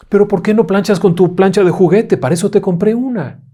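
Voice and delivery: high, loud mother scolding; a tired parent reasoning; mother questioning her daughter, high and loud; exasperated mother. A narrator's reading. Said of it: "mother replies".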